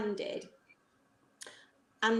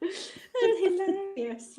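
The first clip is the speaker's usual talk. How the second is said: laughing; 12 semitones higher, slower than usual. Real, not acted. Laughing at snow hockey memory.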